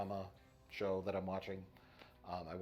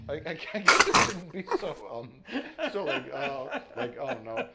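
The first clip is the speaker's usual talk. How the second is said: mock fear; 9 semitones higher, 11 dB louder than usual. Shown as a demonstration. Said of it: "I'm gonna try to do it more fearful".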